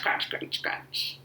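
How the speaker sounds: fading into a whisper; soft whispered crunching. Shown as a demonstration. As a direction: let the crunches fade to a whisper.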